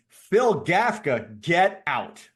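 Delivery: high, loud, drawn-out welcome; excited greeting. Real, not acted. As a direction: greet a late friend with delight. Delighted greeting of arriving friend.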